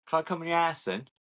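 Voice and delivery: imitating a stand-up's punchline; Mickey Flanagan. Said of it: "that Mickey Flanagan bit".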